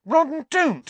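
high, loud, angry protest. A narrator's reading. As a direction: cry out crossly to stop a friend. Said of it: "said Hermione angrily".

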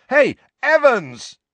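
loud, very high, drawn-out call; shouted. A narrator's reading. James calls after Lily walking away, shouting. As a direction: holler to make her turn round. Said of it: "James shouted after her".

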